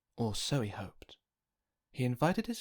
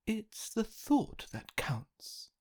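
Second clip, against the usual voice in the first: higher, slow, lightly voiced mother; consoling mother; 11 semitones higher, slower than usual. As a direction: soothe the disappointed son.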